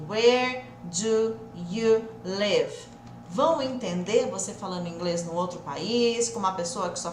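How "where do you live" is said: very slow, word by word with gaps; each word said apart, dragged out; separado, devagarzinho. Shown as a demonstration. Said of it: "Se você falar assim". (bem devagar, palavra por palavra)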